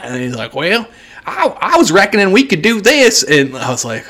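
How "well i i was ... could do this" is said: mock the man planning over the phone; Southern accent. Mocking the man's phone call, a high Southern accent. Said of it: "speak like they're from the panhandle".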